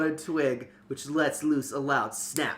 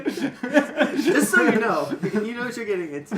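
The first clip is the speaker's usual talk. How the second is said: laughing; 8 semitones higher, 6 dB louder than usual. Real, not acted. Laughing at the town name.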